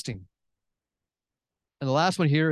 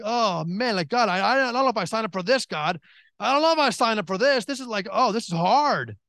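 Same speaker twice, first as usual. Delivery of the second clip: retold complaint; 7 semitones higher than usual. Acts out overwhelmed complaint to God.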